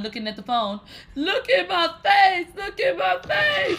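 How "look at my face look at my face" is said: very high hysterical shriek; screaming and crying. Retold or imitated. Showing her hurt nose to the phone, screaming and crying. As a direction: wail it in mock horror at your reflection. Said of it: "I'm just screaming, crying".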